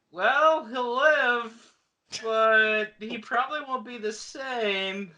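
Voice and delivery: high, drawn-out, doubtful delivery; hedging medic. Retold or imitated. Medic giving a verdict on the patient, hedging in a high voice. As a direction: stretch it out like reluctant reassurance.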